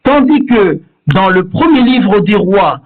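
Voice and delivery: usual speaking voice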